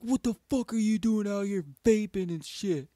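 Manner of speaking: high, loud mock scolding; imitating heated dad. Dad yelling about vaping, imitated heated shout. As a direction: play a dad yelling at his kid. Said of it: "he was straight heated".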